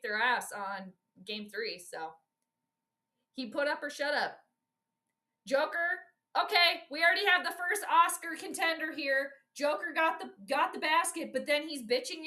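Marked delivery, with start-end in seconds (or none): none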